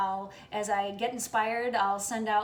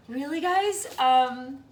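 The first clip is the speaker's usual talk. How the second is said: getting emotional; 3 semitones higher, 11 dB louder, slower than usual. Real, not acted. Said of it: "I get very emotional".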